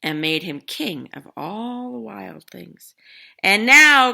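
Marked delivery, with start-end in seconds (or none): 3.4-4.1 cried out. Acted. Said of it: "cried Max".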